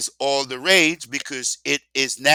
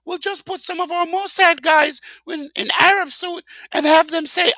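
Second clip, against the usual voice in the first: much higher put-on voice, taunting them; mocking squeaky voice; 4 dB louder than usual. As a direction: squeak it out like a sneering cartoon villain. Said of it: "it's like they said".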